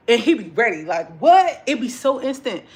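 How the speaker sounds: loud sudden what?; mock snap. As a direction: bark it like you're ready to fight. Chef snapping back instantly.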